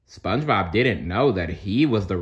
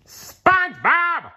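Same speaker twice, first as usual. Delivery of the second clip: very high, slow, loud scream; screaming a name; 4 dB louder, slower than usual. A narrator's reading. Squidward at SpongeBob's door, screaming. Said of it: "Squidward screamed".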